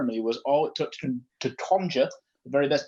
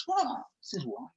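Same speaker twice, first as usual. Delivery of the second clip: amazed; 7 semitones higher, 5 dB quieter than usual. Real, not acted. Reacting to odd patron voice.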